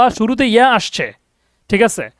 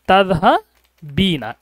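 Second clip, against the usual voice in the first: slow model word; much slower than usual. Shown as a demonstration. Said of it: "সেটা হবে একটু ভিন্ন এটা".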